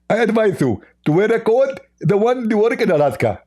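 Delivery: high, squeaky mock voice; a hammed-up high caricature voice; mocking Zakir Naik. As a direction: lampoon the opponent in a silly high pitch.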